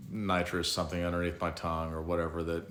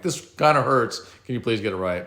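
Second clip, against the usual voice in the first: much louder, higher cry through the pain; pained outburst; 5 semitones higher, 9 dB louder than usual. Retold or imitated. Patient to doctors on their fourth try, loud and pained. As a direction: yelp it at the fumbling doctors. Pain during repeated catheter insertions.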